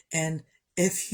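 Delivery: usual speaking voice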